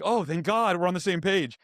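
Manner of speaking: relieved exclamation, replayed for a listener; retold relief. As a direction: act out being let off the hook. Retold relief at agent agreeing.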